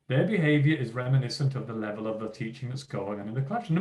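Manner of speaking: ordinary delivery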